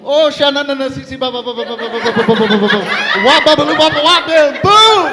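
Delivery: hammed-up rapid babble building to a loud high shout; mock fervent Pentecostal gibberish; praying in tongues. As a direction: chant nonsense syllables, then explode at the end. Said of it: "begins to pray fervently in tongues".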